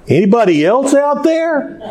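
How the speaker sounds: high, laughing call for anyone else; man on cliff asks for another voice, laughing; laughing punchline. Retold or imitated. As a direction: toss off the punchline through a laugh. Punchline, man calls again.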